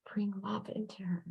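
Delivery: whispered comfort to the tearful sitter; soft whisper. Real, not acted. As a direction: breathe the words out gently. Medium soothing a crying sitter.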